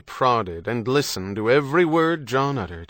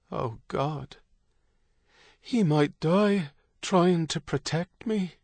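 higher, slow anguished thought; understated dread, drawn out and pitched up; fearful realization; 8 semitones higher, slower than usual. A narrator's reading. Kate fears Stephen might die for her, anguished voice.

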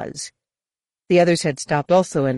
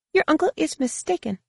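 high-pitched, cool guide correcting him; much higher voice for an aloof woman guide; frosty Feroan guide; 11 semitones higher than usual. A narrator's reading. Guide denies Jacen's uncle's claim, frosty.

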